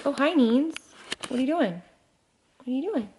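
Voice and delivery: soft, high sing-song to a little one; fond greeting. Real, not acted. Vlogger breaks off to greet Neens, soft and doting. Talking to Neens who came in.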